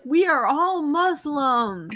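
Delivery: slow, drawn-out politician quote; mock Bill de Blasio. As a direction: stretch it out like a speech. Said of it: "he was like".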